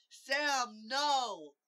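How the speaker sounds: high, loud cry of alarm; a long, high-pitched shout. Acted. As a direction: call out loudly in distress. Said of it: "Midge cried".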